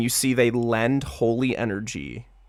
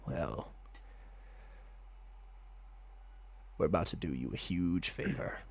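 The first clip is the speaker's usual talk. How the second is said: hushed god voice; 6 semitones lower, 16 dB quieter than usual. Acted. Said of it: "they go".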